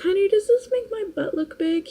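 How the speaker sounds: high, sing-song fishing for a compliment; playful wife baiting her husband; mock coy question. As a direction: ask it sweetly, setting the trap. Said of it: "I'm like".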